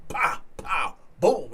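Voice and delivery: punchy spoken impact noises; imitated punches. Teller acting out punches he threw, punchy sound effects. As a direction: mimic blows landing, short and high.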